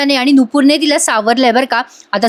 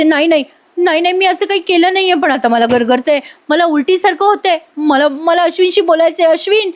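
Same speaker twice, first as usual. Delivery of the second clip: higher, pleading denial, lightly dramatized; acted distressed protest; 6 semitones higher than usual. Priya denies it and asks for Ashwin, acted distressed pleading. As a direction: imitate someone desperately protesting innocence.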